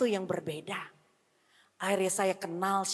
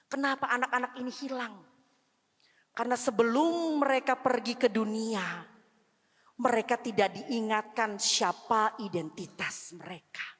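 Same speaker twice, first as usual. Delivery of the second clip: ordinary delivery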